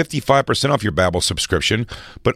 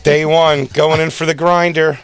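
louder, higher mock eager; mock excitement; 6 semitones higher, 5 dB louder, slower than usual. Host mocking a guest's excitement over a gift grinder. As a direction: ham up the thrill of a grinder.

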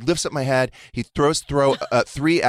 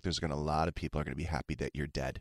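low, much softer, fast dry put-down; deadpan jab; 7 semitones lower, 12 dB quieter, faster than usual. Retold or imitated. Boyfriend mocking his feverish partner, soft and deadpan. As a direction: throw it away dryly.

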